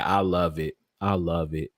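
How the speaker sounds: ordinary talk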